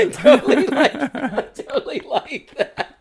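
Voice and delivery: high, slow, helpless laughter through the words; laughing agreement. Real, not acted. Storyteller agrees she sounds like Tom, laughing hard. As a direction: agree while cracking up, words stretched out.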